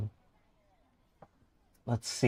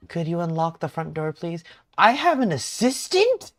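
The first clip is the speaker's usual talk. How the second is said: high voice climbing louder by the end; higher-pitched lady; 7 semitones higher, 6 dB louder than usual. Acted. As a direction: play the lady with a raised pitch. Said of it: "pull a higher pitched voice".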